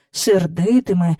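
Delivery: ordinary delivery, nothing marked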